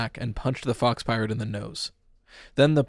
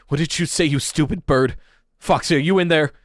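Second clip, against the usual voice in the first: frantic shout; 5 semitones higher, 6 dB louder than usual. Acted. Pete shouting at the bird.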